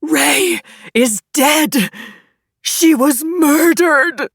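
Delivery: high, loud, drawn-out scream of horror; anguished full-voiced scream. A narrator's reading. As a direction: scream the news out in slow, stretched words. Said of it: "Christoph's screams nearly stopped my heart".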